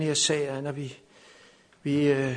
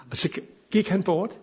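ordinary delivery

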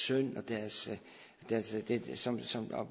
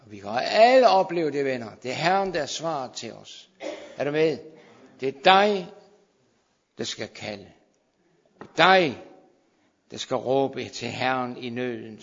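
fiery preaching; 10 dB louder than usual. Real, not acted. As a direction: rouse the congregation at full force. Preacher rouses the congregation.

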